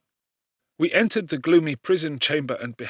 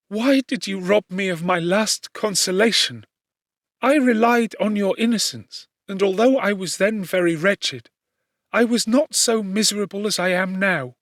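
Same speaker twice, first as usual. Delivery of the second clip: put-on higher, louder young woman's lament; anguished young woman; 7 semitones higher, 5 dB louder than usual. A narrator's reading. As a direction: pour out her grief to the prisoner, pitched up. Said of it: "said she".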